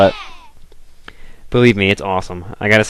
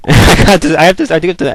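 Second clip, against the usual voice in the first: laughing through the words, pitched up and louder; 8 semitones higher, 6 dB louder than usual. Real, not acted. Speaker catching themself on their own accent remark, laughing. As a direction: break into a laugh as you restart. Laughs while starting to correct herself.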